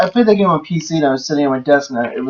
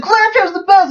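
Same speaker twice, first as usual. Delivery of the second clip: much higher fangirl squeal; high-pitched mock rave about Claptrap; mock gushing fan; slower than usual. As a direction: gush like an overexcited fan. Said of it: "It was like".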